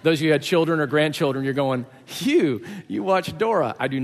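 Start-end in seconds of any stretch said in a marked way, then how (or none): 2.1-3.7 teasing, incredulous reaction; mock disbelief. Parents astonished that the preacher watches cartoons, mock disbelief. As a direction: pitch up in playful surprise.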